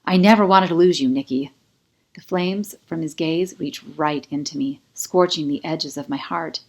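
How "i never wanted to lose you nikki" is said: fiery declaration. A narrator's reading. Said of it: "a blaze of fire in those brown eyes".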